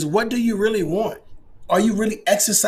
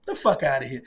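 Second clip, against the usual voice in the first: usual speaking voice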